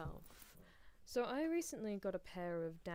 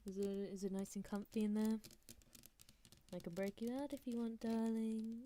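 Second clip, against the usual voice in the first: talking to someone shut in a coffin, raised level; mock-concerned visitor at a coffin; playful coffin-side roleplay; 3 semitones higher, 4 dB louder than usual. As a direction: tease the one chained in the coffin, slightly louder.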